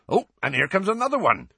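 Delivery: lightly voiced proud half-giant; a hint of a proud gamekeeper; proud Hagrid. A narrator's reading. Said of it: "said Hagrid proudly".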